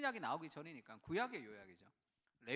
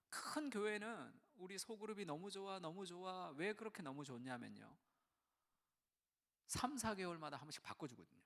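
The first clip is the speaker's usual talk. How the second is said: normal talking voice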